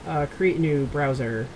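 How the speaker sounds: normal talking voice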